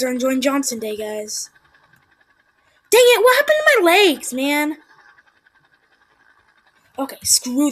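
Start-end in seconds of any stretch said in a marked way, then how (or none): 2.9-4.8 high loud frustrated cry; exasperated yell. Real, not acted. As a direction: cry out at the game. Legs lost in game.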